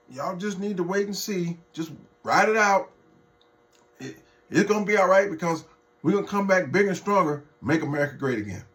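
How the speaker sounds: high, fast, chirpy reassurance; mock Trump supporter. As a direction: parody a cheerful MAGA fan. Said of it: "all his supporters are talking about".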